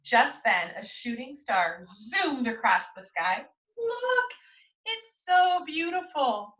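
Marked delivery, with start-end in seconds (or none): 3.8-6.5 delighted look. Acted. Child spots shooting star.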